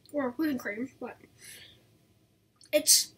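chewing while talking; talking between bites of chocolate; mouth full. Real, not acted. Seen 1: bites treat at 0.4–1.2, then talks while chewing, treat in hand.

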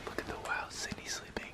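soft unvoiced whisper; whisper, don't wake her. Real, not acted. Partner sleeping nearby.

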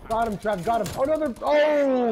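loud, high panicked yell; alarmed shout. Real, not acted. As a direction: yell as you get caught out. Getting killed in a firefight.

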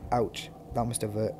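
ordinary talk, nothing marked

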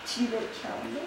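hushed, barely voiced; stage whisper. Real, not acted. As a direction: breathe it out for lip-reading. Mouthing phrase for lip-reading.

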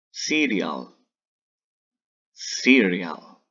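"Cereal" said as English "siɾial", slow and clear; modeling English word. Shown as a demonstration. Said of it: "cereal se dice así". ("Cereal" pronunciado a la inglesa, "siɾial")

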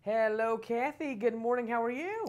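bright-spirited high-pitched hello; cheery voice. Real, not acted. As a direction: welcome them in a high, cheery voice. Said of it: "I love your cheery voice".

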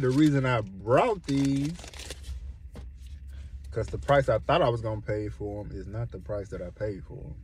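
usual speaking voice

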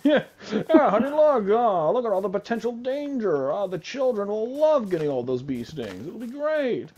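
high, loud oblivious sales pitch; mock excited parent. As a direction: gush like a clueless parent. Voicing a parent at the shed.

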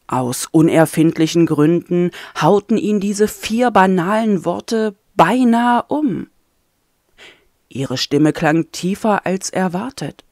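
plain reading voice

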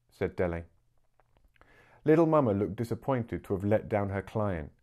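usual speaking voice

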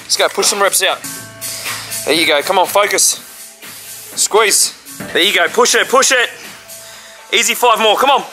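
counting workout reps. Real, not acted. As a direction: push him through every rep. Spotting partner through a set.

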